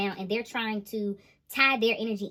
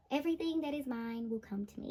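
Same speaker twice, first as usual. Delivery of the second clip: much softer, calm manifesting voice; serene affirmation; 2 semitones higher, 8 dB quieter than usual. Retold or imitated. Empress calmly manifesting her energy, soft and serene. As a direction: breathe it out like a calm mantra. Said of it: "the Empress is like".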